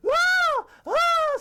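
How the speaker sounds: piercing, very high loud shriek; cartoon scream. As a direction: scream at full pitch like a terrified cartoon. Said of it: "It's still going on".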